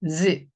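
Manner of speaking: 지 with a buzzing z-like onset, lips closed tight; voiced buzzing j. Shown as a demonstration. Teacher shows students the buzzing 지 sound. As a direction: say 지 with a ringing, S-like buzz. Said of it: "좀 울리는 소리가 나요".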